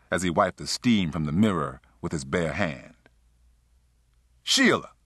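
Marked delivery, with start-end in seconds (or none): 4.4-4.9 high-pitched call to someone in another room. A narrator's reading. Man calls for his lover from the bathroom, calling out. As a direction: call out her name, voice pitched right up. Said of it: "he called out".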